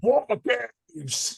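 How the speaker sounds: put-on lightly done British Blackpool accent, War Games; a hint of a Blackpool wrestling announcer; Steven Regal impression. Host imitating Steven Regal for his guest, in a light Blackpool accent. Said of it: "more like Steven Regal".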